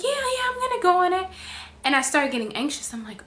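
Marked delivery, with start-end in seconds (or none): 0.0-1.3 much higher, louder forced cheer; cheery overconfident self-quote; mock confident. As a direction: fake bravado, way up high. Retold self, overly bright.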